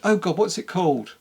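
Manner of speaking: ordinary delivery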